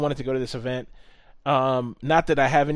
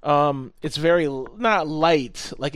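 usual speaking voice

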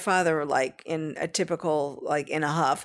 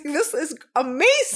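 gushing daughter; 7 semitones higher, 3 dB louder than usual. Retold or imitated. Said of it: "She's like".

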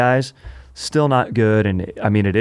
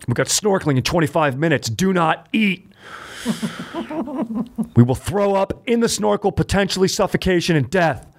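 mock drill-sergeant vacationer; 11 semitones higher than usual. Mock bossy vacation orders.

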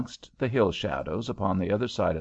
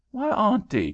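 put-on girl's cry; 12 semitones higher than usual. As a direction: cry out in disbelief. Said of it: "cried the girl".

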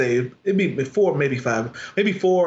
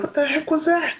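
baffled; 14 semitones higher than usual. Retold or imitated. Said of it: "and we go out there like".

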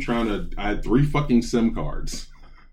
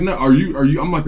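ordinary talk, nothing marked

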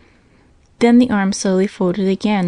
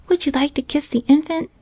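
put-on higher, light child's voice; little girl voice; 8 semitones higher than usual. A narrator's reading. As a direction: voice a gentle young girl. Little girl Minnie asks.

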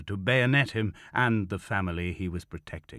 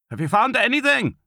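high, loud shouted question; calling out; 7 dB louder than usual. A narrator's reading. Commander checking on the searchers, calling out. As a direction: holler it to someone in another room. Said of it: "Kroll called".

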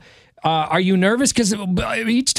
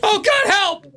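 panicked plea; 13 semitones higher than usual. Pretend. Pretend hostage pleading on phone.

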